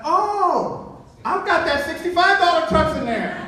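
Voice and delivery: high, loud eureka moment; acted-out bright idea; sudden realization. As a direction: hit on the answer with glee.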